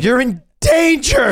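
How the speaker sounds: wounded soldier gasping. Acted. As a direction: gasp it out like a dying soldier. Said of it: "He's trying to cry".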